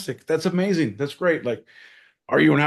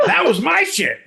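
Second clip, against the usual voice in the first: much higher, louder, laughing; laughing boast; 13 semitones higher, 5 dB louder than usual. Acted. Bragging to cohost, laughing loud. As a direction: crow it through laughter.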